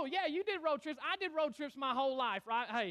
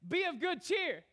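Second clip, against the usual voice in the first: lively higher-pitched call across to someone; mock calling out, raised pitch; crying out; 6 semitones higher than usual. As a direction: call it out to hearten them. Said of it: "he cries out to him".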